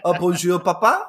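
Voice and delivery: hammed-up French accent, lifted pitch; mock French kid talk. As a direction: play a toddler gone suddenly French.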